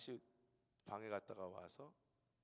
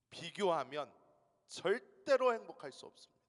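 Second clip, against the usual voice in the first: 비교하면 then 절대로 thundered much louder and higher; emphatic preaching; 5 semitones higher, 13 dB louder than usual. Shown as a demonstration. Pastor warning congregation about comparison, loud emphatic. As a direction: hammer it home. Forceful repeat in sermon.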